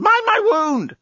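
very high yelp of protest; pained yell. A narrator's reading. Journalist protecting his wound from Lucy, yelling. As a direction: yelp a warning in pain. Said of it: "the journalist yelled".

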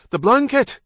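loud, high-pitched command; urgent shout. A narrator's reading. Husband to wife as the baby slides out, shouting. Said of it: "Stephen shouted".

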